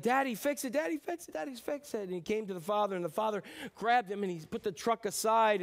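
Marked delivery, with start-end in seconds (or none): 0.0-2.0 put-on high, pleading little boy voice; crying child's plea. Boy with a broken truck begging his dad, pleading high. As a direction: beg like a sobbing four-year-old. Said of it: "He's like".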